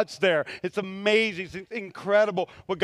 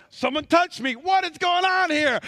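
acted demand; 9 semitones higher, 5 dB louder than usual. Jesus halted by someone's touch, loud acted demand.

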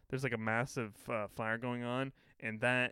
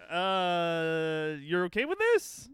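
much higher, louder disbelieving question; mock incredulous; 5 semitones higher, 6 dB louder, much slower than usual. Friend voices a baffled cast member, high and loud. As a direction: sound baffled at the script. Voicing a shocked writer.

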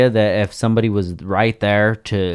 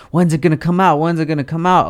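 higher-pitched, mildly impatient pestering; eager fan; 7 semitones higher, faster than usual. Acted. Fan waiting on the delayed album, mock eager. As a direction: play a fan nagging for a release date.

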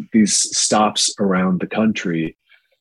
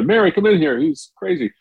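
calling to mother; 11 semitones higher than usual. Retold or imitated. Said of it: "said to my mother".

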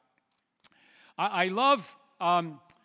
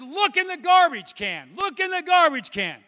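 loud, high shouted command from the wife; imitating a shout; 11 semitones higher, 13 dB louder than usual. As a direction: yell it urgently, imitating the wife. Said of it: "she said".

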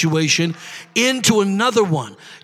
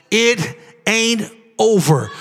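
slow, measured declaration; slow prophecy; slower than usual. Shown as a demonstration. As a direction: lay it down slowly like a prophecy. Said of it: "Let me prophesy over you this morning".

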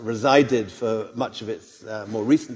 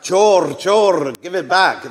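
loud, higher-pitched mock protest cry; angry shout; 7 semitones higher, 9 dB louder, slower than usual. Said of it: "uh, angrily and shout".

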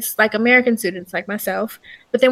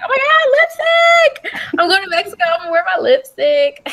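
excited squeal; 10 semitones higher, 4 dB louder than usual. Retold or imitated. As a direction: gush over the lipstick like a kid. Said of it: "but I was so excited".

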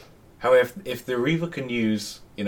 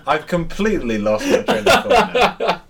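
laughing; 10 semitones higher, 5 dB louder than usual. Real, not acted. Still laughing.

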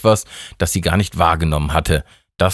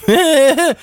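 short high burst of laughter; 4 dB louder than usual. Acted. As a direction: crack up at the joke. Mike laughs at joke. (hohes kurzes Lachen)

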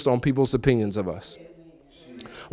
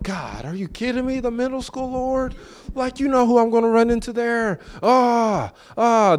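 high, pleading mock complaint to the Lord; exasperated whine to God; 9 semitones higher than usual. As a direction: whine it up high like you can't believe it.

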